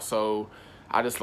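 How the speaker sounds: usual speaking voice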